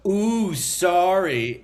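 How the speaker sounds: drawn-out, high and loud fake sorry; exaggerated, sing-out "oops, sorry" attitude; mock apology. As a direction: imitate a sarcastic apology, stretching it out. Retold mock apology from man.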